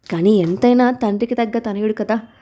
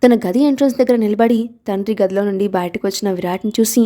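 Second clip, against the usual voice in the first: ordinary delivery, nothing marked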